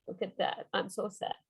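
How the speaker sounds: understated storybook sadness; mock sad. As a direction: droop the voice like a sad child. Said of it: "And this is about feelings".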